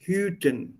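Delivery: "Hüten" with the ü held long; "Hüten" said with a long, pure ü; long umlaut. Shown as a demonstration. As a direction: stretch the first vowel of "Hüten". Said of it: "U umlaut is long".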